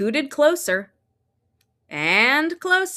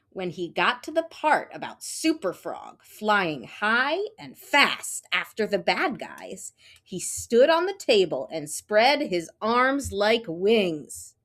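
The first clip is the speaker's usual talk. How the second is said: ordinary delivery, nothing marked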